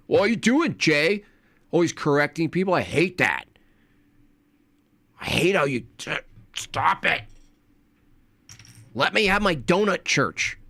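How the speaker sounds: a hint of a petty heckler; mock whiny critic. Mocking critics whining at Jay.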